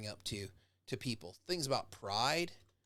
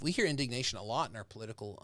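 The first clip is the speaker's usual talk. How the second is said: ordinary talk, nothing marked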